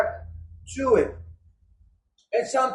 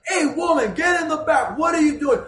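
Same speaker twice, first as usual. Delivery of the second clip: higher, loud-edged calling out at a woman; scolding men; 5 semitones higher, 4 dB louder than usual. Acted. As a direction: bark orders like indignant men in a crowd.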